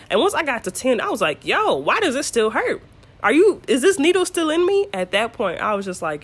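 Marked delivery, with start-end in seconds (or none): 1.5-4.9 alarmed complaint. Retold or imitated. Acted alarm during epidural.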